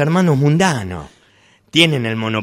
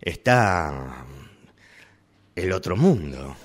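soft, lower confiding retake with pauses; softer, slower retake; 7 semitones lower, 8 dB quieter, slower than usual. Acted. Shopkeeper confiding to customer, soft and slow. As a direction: drop the voice and take your time. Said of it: "Vayamos de vuelta, vos tirale este".

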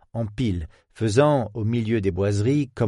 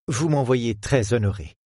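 usual speaking voice

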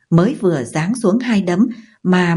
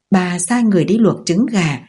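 usual speaking voice

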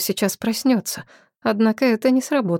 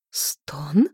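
startled whisper; 4 semitones lower than usual. Acted. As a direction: breathe it out in alarm. Heroine startled by moan.

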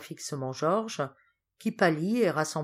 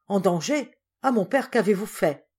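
higher, louder shocked question; dismayed, raised-voice exclamation; alarmed reproach; 4 semitones higher, 6 dB louder than usual. A narrator's reading. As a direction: cry out in dismay at a father. Son alarmed by news of danger.

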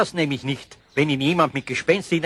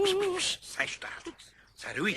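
hushing; 14 semitones higher, 10 dB quieter than usual. Acted. Eder hushing Pumuckl.

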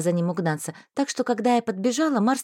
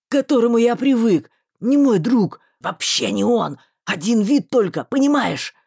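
agitated male voice; 2 semitones lower, 5 dB louder than usual. A narrator's reading. Marston pleading with the heroine, agitated. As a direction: make her understand.

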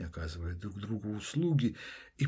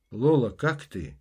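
calling out; 2 semitones higher, 8 dB louder than usual. Acted. Psychiatrist calls through door.

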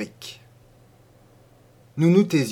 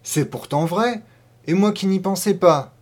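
higher voice of sudden realisation; exclaiming; 6 semitones higher, 5 dB louder than usual. A narrator's reading. Farmer's wife agreeing with her husband, exclaiming. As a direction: lift the pitch as it dawns on her. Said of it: "s'écria la fermière".

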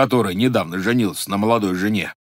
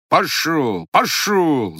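shouting; 6 semitones higher, 4 dB louder, much slower than usual. Acted. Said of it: "закричал кузнец".

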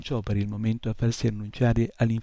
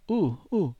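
startled imp; 6 semitones higher than usual. A narrator's reading. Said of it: "esclamò".

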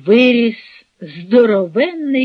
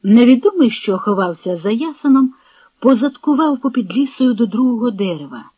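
ordinary delivery, nothing marked

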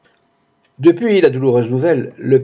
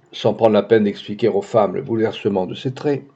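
plain reading voice

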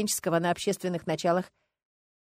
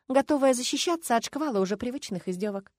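ordinary delivery

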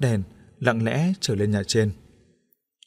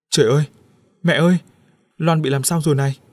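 loud, high panicked shout; shouting in alarm; 5 semitones higher, 5 dB louder, faster than usual. A narrator's reading. Husband finding his wife fainted, shouting panicked to her mother. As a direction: yell for help, alarmed. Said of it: "Quý la lớn".

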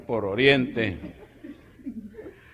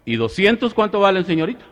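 raised customer voice; 9 semitones higher, 6 dB louder than usual. Acted.

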